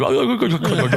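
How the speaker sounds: laughing through the line. Real, not acted. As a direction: crack up while saying it.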